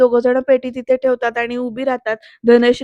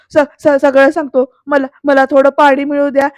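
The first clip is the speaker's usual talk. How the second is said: louder, stuttering acted surrender; panicked stammer; 2 semitones higher, 6 dB louder than usual. Beaten Vijay begs Shirke for water, stammering. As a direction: stutter and plead like someone cornered.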